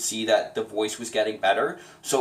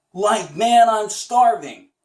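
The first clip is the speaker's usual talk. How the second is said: higher and louder, slow; a step higher, stretched vowels; higher still; 7 semitones higher, 6 dB louder, slower than usual. Shown as a demonstration. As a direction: climb up the range. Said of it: "see how high your voice can go".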